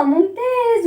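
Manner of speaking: ordinary delivery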